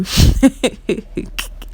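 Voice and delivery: breathy burst of laughter at herself; amused laugh. Real, not acted. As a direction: laugh at your own Igbo word. Laughs at own expression.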